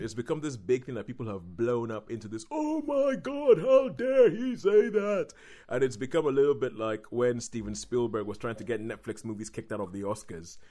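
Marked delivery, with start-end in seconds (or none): 2.5-5.3 high, drawn-out pearl-clutching horror; hammed-up scandalised gasp of a voice, slow and loud; mock outrage. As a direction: act appalled in a mocking falsetto. Mocking people's overblown outrage.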